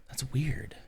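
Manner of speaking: a breathy whispered aside. Real, not acted. Streamer whispering to herself about the odd tradition, whispered. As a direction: whisper it to yourself, puzzled.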